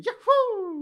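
high, loud whooping game-hero cry; Super Mario. Acted. Friend joking about skiing in a game level, Mario impression. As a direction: whoop it out like a cartoon plumber. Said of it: "That sounds like a Super Mario level".

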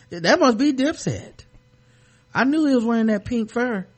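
ordinary delivery

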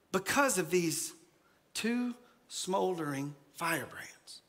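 slow fade to a whisper; hushed. Retold or imitated. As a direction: drop it to a secret. Reading the verse.

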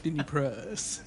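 lightly put-on accent mangling Disney Plus; a hint of an accent on Denny Prus; mock accent. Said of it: "do the accent".

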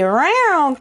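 playful rising meow; cat yowl. Pretend. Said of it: "Can anybody say".

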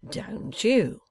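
high, mocking question; scornful little taunt; sneering. A narrator's reading. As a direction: sneer it back at him. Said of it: "sneered Wegg".

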